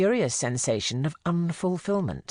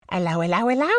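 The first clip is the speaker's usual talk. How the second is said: higher, bouncy hello with rising tune; cheery greeting; 5 semitones higher, 3 dB louder, faster than usual. A narrator's reading. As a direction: greet a sick man brightly. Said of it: "said Missus Harris".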